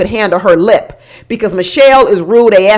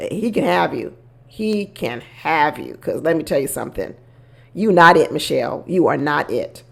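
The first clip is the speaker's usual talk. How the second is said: much softer, lower, dismissive; low dismissive aside; 6 semitones lower, 8 dB quieter than usual. Real, not acted. Telling Michelle she is not it, soft and low. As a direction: let it drop low, done with her. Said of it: "dropping voice to Michelle".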